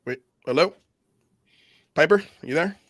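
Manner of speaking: cutting in and out; breaking up on the call; bad phone line. Real, not acted. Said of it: "you're coming in and out".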